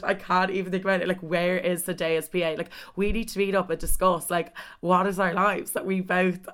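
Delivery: normal talking voice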